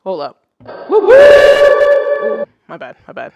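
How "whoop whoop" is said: very high, piercing whoop; loud whoop. Real, not acted. Streamer whoops for the chat, very loud and high. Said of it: "It got loud in my ears".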